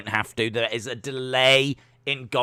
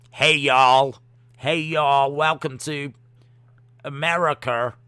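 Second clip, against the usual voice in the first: American accent. Shown as a demonstration. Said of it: "But I know it's not American".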